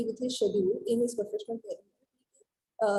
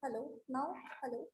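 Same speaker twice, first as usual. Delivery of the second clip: barely audible; 10 dB quieter than usual. Real, not acted. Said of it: "Your voice is very low".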